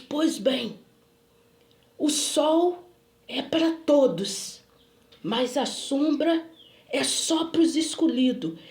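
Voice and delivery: slow, emphatic imitated prophet; acted commanding pronouncement, deliberate and firm; solemn proclamation. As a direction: declare each phrase weightily, with pauses. Retold man's solemn pronouncement.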